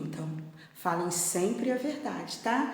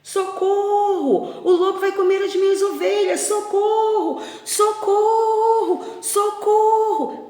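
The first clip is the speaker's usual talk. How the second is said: high, loud, long-held cries; frightened screaming for help; 12 semitones higher, 8 dB louder, slower than usual. Acted. Terrified shepherd boy calling for help as the wolf attacks, desperate shout. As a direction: scream for rescue, stretching each call. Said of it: "ele começou a gritar".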